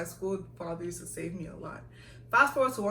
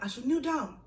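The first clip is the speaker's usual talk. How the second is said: lightly voiced bossy senior; 6 semitones higher than usual. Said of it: "she was like".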